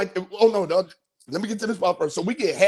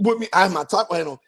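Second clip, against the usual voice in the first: usual speaking voice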